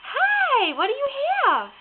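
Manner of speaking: put-on high cooing baby talk.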